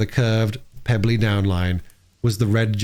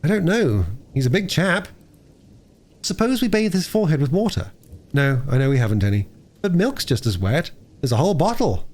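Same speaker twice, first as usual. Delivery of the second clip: lightly voiced children, pitched higher; children working out a rescue; 6 semitones higher than usual. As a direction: puzzle it out in high child voices.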